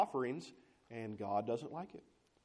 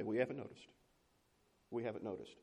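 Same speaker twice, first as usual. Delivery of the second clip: 1 sullen muttered reply; 4 semitones lower, 7 dB quieter, faster than usual. Acted.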